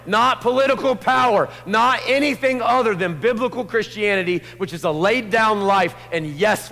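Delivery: high, loud full-force sermon voice; fiery preaching. Acted. Preacher urging the church to wake up, full force. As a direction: preach it hard to the whole room.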